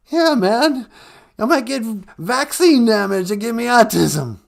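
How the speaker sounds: a scared caller losing his nerve; Cowardly Lion freaking out. Acted. Radio caller panicking about the vaccine, loud and high. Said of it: "he sounds totally different today".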